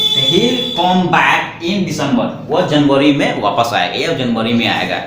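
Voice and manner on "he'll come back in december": very slow, each word set apart for learners; slow dictation. Shown as a demonstration. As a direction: spell it out slowly like a teacher. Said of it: "he will को हम contrast करके हिल बोलेंगे". (धीरे-धीरे, हर शब्द अलग करके)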